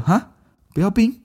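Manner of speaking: puzzled mom. Retold or imitated. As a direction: imitate mom's baffled squeak. Mom puzzled by odd order.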